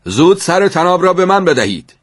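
high, urgent shouted order; shouting. A narrator's reading. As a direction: yell for it to be handed over. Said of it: "فریاد زد".

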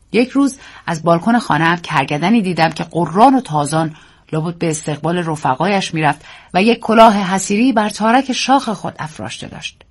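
usual speaking voice

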